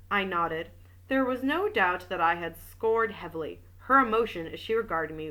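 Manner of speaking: even audiobook reading voice; story narration. A narrator's reading. Reading a detective novel aloud, steady narration. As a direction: read the novel aloud to listeners.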